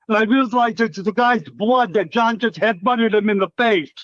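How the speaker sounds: usual speaking voice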